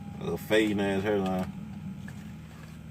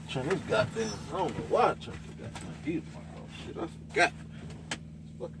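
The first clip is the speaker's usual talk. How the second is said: muttered to self; 3 semitones higher, 10 dB quieter than usual. Real, not acted. Muttering to himself in cold truck.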